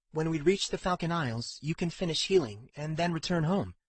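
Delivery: gently lifted voice, advising a companion; earnest Katrin character. Acted.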